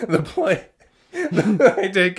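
high, laughing false start; can't stop laughing. Real, not acted. Host trying to announce the worst blooper, helpless laughter. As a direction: break up laughing mid-phrase. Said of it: "I can't say it without laughing".

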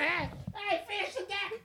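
high, laughing wife's order; bossy wife voice. Retold or imitated.

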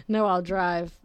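ordinary talk

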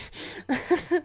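short, nervous giggle; nervous laugh. Real, not acted. Podcaster catching herself stumbling over words from tiredness, nervous laugh. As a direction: let out a small nervous laugh. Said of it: "I'm laughing nervously".

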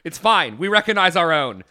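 loud and laughing. Real, not acted. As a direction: blurt it out loudly. Loud joking self-admission.

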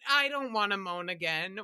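usual speaking voice

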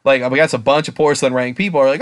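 normal talking voice

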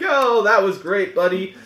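high, bright fake friendliness; mock cheery greeting. Player character covering for eavesdropping, falsely cheery. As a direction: greet him with forced cheer. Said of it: "and you're like".

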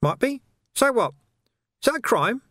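high, sly, wheedling urchin voice; high-pitched cockney urchin hustling a copper; cheeky street urchin. Acted. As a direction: haggle like a grubby street kid. Urchin Nobby's voice.

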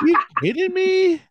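high, loud disbelieving question; you can't be serious; incredulous. Real, not acted. Defends wanting the injury.

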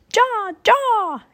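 very high, long-held bird call; name stretched into a high caw; calling raven. A narrator's reading. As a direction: call from outside like a cawing raven. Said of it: "Annie was calling to him".

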